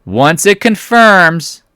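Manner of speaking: "confirms" very high, loud and drawn out; long, soaring, loud "confirms"; emphatic repeat. Shown as a demonstration. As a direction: sing out "confirms" slowly for maximum emphasis. Repeats rule a third time, bigger.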